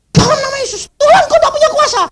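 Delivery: very high-pitched, fast panicked plea; retold frantic prayer. Preacher retells rebuking robbers in vain, frantic high squeal. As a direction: squeal out a hurried, hammed-up prayer.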